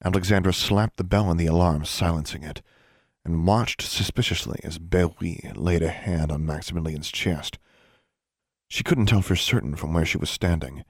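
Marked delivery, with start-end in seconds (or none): none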